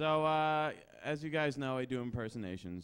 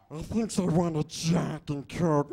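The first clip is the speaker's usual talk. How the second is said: loud, slow, rasping creature voice; hammed-up split-personality creature, the harsh side; Schmiegel; 3 semitones higher, 10 dB louder, slower than usual. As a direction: growl out the craving. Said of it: "a nice impression of Schmiegel".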